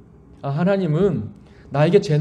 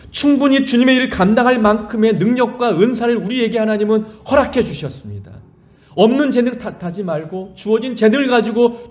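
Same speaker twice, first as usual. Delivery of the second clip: louder, higher fervent sermon; preaching at full force; 5 semitones higher, 4 dB louder than usual. Acted. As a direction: drive the point home to the congregation.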